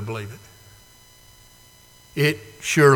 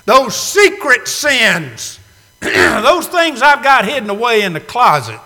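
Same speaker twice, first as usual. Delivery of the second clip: anguished confession; 5 semitones higher, 6 dB louder than usual. Retold or imitated. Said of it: "who are thinking".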